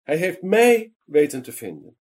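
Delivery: high, loud, jubilant cry; overjoyed shout of a little man running home; cheering shout. A narrator's reading. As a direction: shout it out with pure joy. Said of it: "schreeuwde en juichte".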